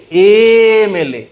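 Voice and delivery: এমএলএ stretched very slowly, opening e clearly voiced; এমএলএ said slowly and deliberately; slow pronunciation demo. Shown as a demonstration. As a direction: draw out এমএলএ to show its e sound. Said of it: "প্রোনাউন্সিয়েশনটা check করো".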